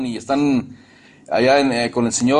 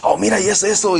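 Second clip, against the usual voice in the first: higher-pitched, agitated imitation of himself; worked up; 6 semitones higher than usual. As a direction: act out being all wound up. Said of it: "Estoy acelerado". (imitando un tono acelerado)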